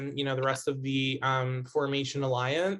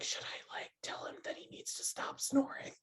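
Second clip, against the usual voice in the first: whispered imitation, barely any voice; scary voice; 9 semitones higher, 8 dB quieter than usual. Kept awake by someone snoring, mock scary whisper. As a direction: act out a hushed, secretive complaint. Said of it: "Why are you doing scary voice?".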